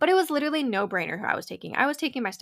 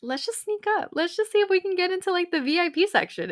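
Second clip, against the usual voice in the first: higher, scheming whisper-light urging; urging her friend to sneak into VIP, higher and excited; sneaky excited; 8 semitones higher than usual. Retold or imitated. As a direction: coax a friend into mischief. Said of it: "And I was like".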